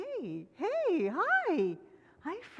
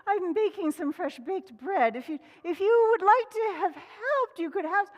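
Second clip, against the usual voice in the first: higher, louder hen offer; 8 semitones higher, 6 dB louder than usual. Acted. Hen inviting Rabbit to help, higher voice. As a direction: offer sweetly. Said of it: "She said".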